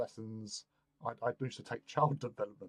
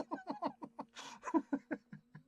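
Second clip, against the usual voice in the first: high laugh; 5 dB quieter than usual. Real, not acted. Laughing at his own retort.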